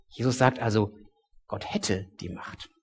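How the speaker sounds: usual speaking voice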